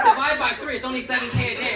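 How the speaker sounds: mock her innocent logic while cracking up; retelling a teammate's blunder, laughing hard; laughing quote. Retelling colleague's naive math, laughing.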